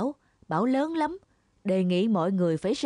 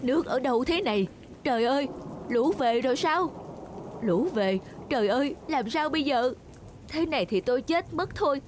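higher, rising alarm as the water comes in; panicked; 5 semitones higher than usual. Acted. Cow trapped in the flooding stall, higher panicked cries. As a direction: fret aloud, voice climbing in panic.

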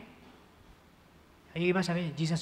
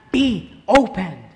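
slow, louder "Be opened" as an order; slow louder command; 7 dB louder, much slower than usual. Acted. Pastor voicing Jesus commanding the deaf man, slow and louder. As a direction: command the ears to open.

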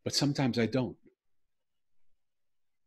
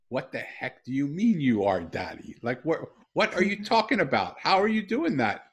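retold disbelief, louder and higher; astonished; 7 semitones higher, 9 dB louder than usual. Dad retelling his shock at his daughter, loud astonished questions. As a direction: relive the shock, voice jumping up.

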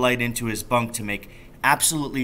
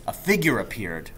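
ordinary delivery, nothing marked

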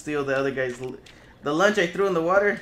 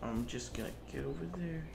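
quiet; 3 semitones lower, 14 dB quieter than usual. Real, not acted. Sneaking, murmuring to self.

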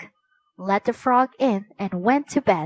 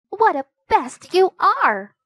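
annoyed princess; 9 semitones higher, 3 dB louder than usual. Acted. Princess scolds the noisy frog.

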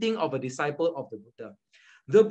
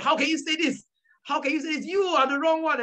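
pitched way up, indignant protest; mock angry retort; 10 semitones higher than usual. A believer hurt by criticism, mock indignant. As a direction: play the offended believer snapping back.

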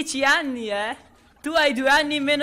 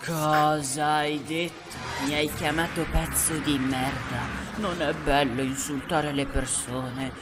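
low, menacing threat; minaccioso; 10 semitones lower than usual. Acted. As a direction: threaten in a much lower pitch. Menacing reply to insult. (minaccia cupa, a voce bassa)

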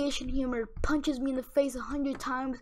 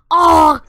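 loud, high mock shriek of outrage; a loud, high, hammed-up fangirl shriek; mock scream; 3 semitones higher, 19 dB louder than usual. Imitating an angry fan of the singer, loud high mock scream. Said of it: "Don't start screaming at me".

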